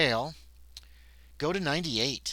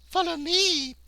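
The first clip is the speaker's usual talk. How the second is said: much higher seductive purr of a brooding maid; 6 dB louder, slower than usual. Acted. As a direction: purr it, high and teasing. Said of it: "purrs the brooding maid".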